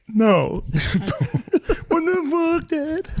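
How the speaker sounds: high, squeaky denial through laughter; mock teen denial. Laughing son mimics his younger self lying to dad. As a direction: squeal the lie like a caught teenager. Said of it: "He's like".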